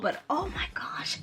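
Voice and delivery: hushed confiding whisper. Real, not acted. Telling viewers how the scent grew on her, whispered. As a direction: whisper it like a secret.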